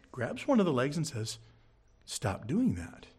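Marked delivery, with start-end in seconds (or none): 2.1-3.1 slow, softer and firm, "Stop doing that" held calm; a parent stopping the baby, gentle but firm; firmly but gently. Retold or imitated. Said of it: "firmly but gently".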